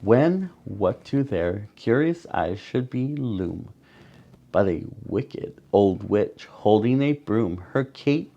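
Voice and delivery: plain reading voice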